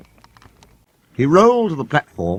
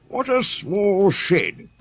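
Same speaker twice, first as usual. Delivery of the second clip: high, slow grumble of a haughty engine; grumbling Sir Handel; 9 semitones higher, much slower than usual. A narrator's reading. Proud engine grumbling at the small shed. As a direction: drag the words out in disgust. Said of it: "grumbled Sir Handel".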